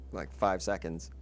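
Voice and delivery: ordinary delivery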